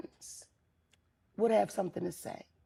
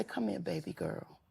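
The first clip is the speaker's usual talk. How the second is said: put-on softer, tender call to a young girl; gentle auntie; 4 semitones lower, 8 dB quieter than usual. Auntie softly calling a girl in a bonnet over. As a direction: coax her over kindly. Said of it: "I say".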